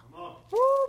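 high, loud "woo"; excited whoop. Real, not acted. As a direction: let out a preacher's joyful whoop. Preacher whoops after verse.

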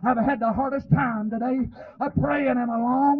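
normal talking voice

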